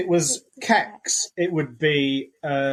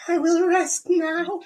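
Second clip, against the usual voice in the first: mock dying miner; 14 semitones higher than usual. Dying coal miner's melodrama.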